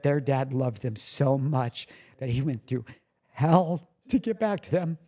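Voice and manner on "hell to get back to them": slow, higher, heartfelt strain; real emotional. Real, not acted. Father vowing to get back to his boys, emotional. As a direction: draw it out, moved and pitched up. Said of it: "didn't mean to get all emotional".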